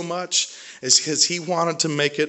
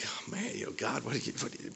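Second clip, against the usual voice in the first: exasperated mutter; 4 semitones lower, 17 dB quieter, much faster than usual. Acted. Gideon whining back at God.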